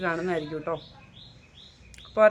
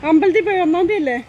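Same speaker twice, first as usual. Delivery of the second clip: calling out; 9 semitones higher, 9 dB louder than usual. Real, not acted. Calls out to a passerby.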